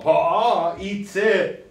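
"itse akau" stretched out slowly, syllable by syllable; slow model phrase. Shown as a demonstration. Teacher slowly models "itse akau" for students to repeat. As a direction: spell out "itse akau" slowly so every sound is heard. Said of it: "Say itse akau".

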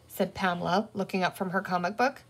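ordinary delivery, nothing marked

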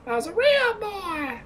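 put-on high, squeaky childish voice; squeaky little boy voice. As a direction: pipe it up like a little kid. Mocking reply about sissy.

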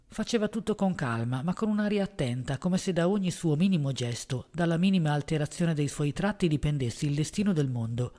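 ordinary delivery

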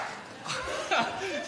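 airy amused chuckle; breathy laugh. Acted. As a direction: laugh softly under your breath. Joke continues.

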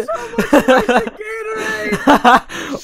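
high-pitched, drawn-out real laugh; helpless laugh. Real, not acted. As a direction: crack up until it squeals high. Cracks up at own story.

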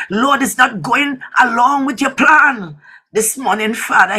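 normal talking voice

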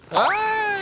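sharp high cry of pain. Acted. Painter hit on the head, crying out in pain. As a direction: yelp as if just struck.

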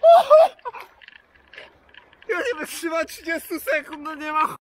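shocked yelps from the freezing water; in ice cold water. Real, not acted. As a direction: gasp and shout as the cold hits. Cold-water plunge, gasping shouts. (okrzyki szoku w lodowatej wodzie)

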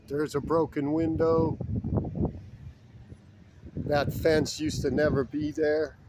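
ordinary talk

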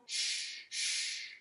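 soft unvoiced hush; hushing shh. Shown as a demonstration. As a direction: hush the snow so it doesn't fall. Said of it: "And we sh, sh, two times".

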